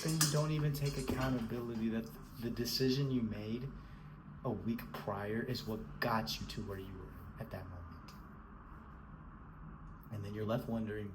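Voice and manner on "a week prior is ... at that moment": ordinary talk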